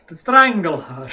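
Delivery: To strangle her punched loud in a mock German accent. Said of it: "I can't hold a German accent for long".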